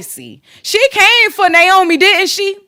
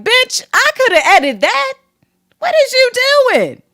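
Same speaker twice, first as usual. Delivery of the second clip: mock scoff; 8 semitones higher than usual. Imagined retort at bad editing.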